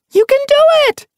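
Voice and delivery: very high, loud encouragement; cheering shout. A narrator's reading. Sun Paw cheers her friend in the water, loud.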